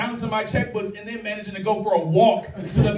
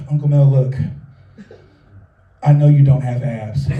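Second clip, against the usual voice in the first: put-on deadpan child; 6 semitones lower, 5 dB louder than usual. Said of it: "She goes".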